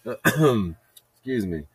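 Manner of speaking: ordinary talk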